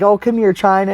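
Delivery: high, loud cooing to a dog; dad's doggy talk. Retold or imitated. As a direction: imitate an owner sweet-talking his pet. Dad sweet-talking his dog, retold.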